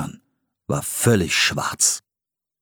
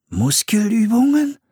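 high, startled question; alarmed boy's inner question; 13 semitones higher than usual. A narrator's reading. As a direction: recoil at the thought, pitched way up. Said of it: "dachte Philipp erschrocken".

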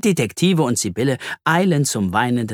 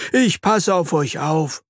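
slow, assured promise, pitched up; confident promise; 6 semitones higher, slower than usual. Acted. Earthworm promises to watch over friends, assured. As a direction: take charge as protector. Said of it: "verspricht Herr Regenwurm".

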